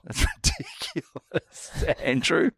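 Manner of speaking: high, helpless laughing speech. Real, not acted. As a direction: squeeze the words out through real laughter. Cracking up over wrong name.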